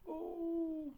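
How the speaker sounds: very high loud oh; high shout. A narrator's reading. Saucepan man shouts from above the clouds. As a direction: cry out in shock. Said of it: "shouted the saucepan man".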